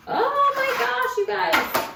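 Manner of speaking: excited squeal. Real, not acted. Gasping at the pretty beads.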